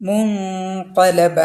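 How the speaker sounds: മുൻഖലബ drawn out very slowly with the ന hidden in nasal hum. Shown as a demonstration. Teacher showing ikhfa by reciting slowly. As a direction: stretch it, let the noon fade into the nose. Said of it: "മറച്ച് വെച്ചിട്ട് മണിക്കുക".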